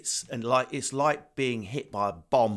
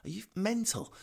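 incredulous; 11 semitones higher than usual. Retold or imitated. His retold disbelieving reply.

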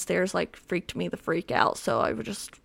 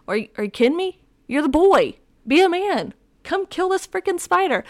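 higher and louder, mock exasperated yell at boyfriend; mock outraged demand; 9 semitones higher, 6 dB louder than usual. Girlfriend yelling at boyfriend to kill the spider, mock exasperated. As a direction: rise into a fed-up demand.

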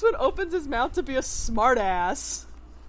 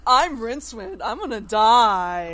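whiny mock lament; 7 dB louder than usual. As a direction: wail it like a drama queen. Mocking Rincewind's whining.

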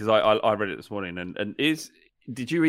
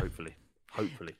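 hushed hope; 7 semitones lower, 14 dB quieter, much faster than usual. Real, not acted.

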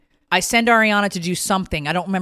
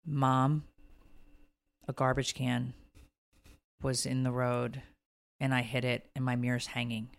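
soft, hushed, hesitant confession; sheepish and quiet; small guilty voice; 6 semitones lower, 11 dB quieter than usual. Retold or imitated. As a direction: daughter confessing the damage to mom, softly. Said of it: "when I saw her name".

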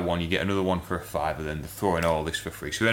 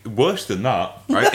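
usual speaking voice

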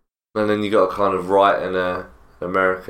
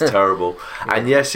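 ordinary talk, nothing marked

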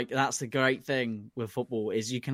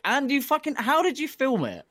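high, excited disbelief; surprised; 11 semitones higher, 4 dB louder than usual. Real, not acted. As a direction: ask it in amazed delight. Amazed at the video.

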